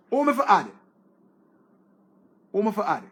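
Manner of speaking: loud, emphatic call with falling end. Real, not acted. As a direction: call it out to the viewers. Calling out to live viewers.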